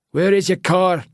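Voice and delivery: high, demanding Scottish question; curt Scottish accent. A narrator's reading. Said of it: "he says in a Scottish accent".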